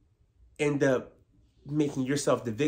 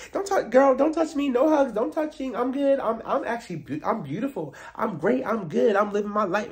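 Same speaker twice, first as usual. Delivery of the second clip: high, louder mock rant; mock diva rebuff; 10 semitones higher, 4 dB louder than usual. Mocking Gizelle refusing Wendy, high and smug.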